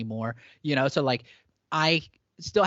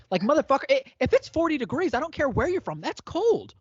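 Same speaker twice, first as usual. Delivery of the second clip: high, louder exasperated rant; 12 semitones higher, 3 dB louder than usual. Real, not acted. Fed up with people doubting he's cold, exasperated rant. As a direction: vent the frustration, pitched way up. Venting about cold complaints.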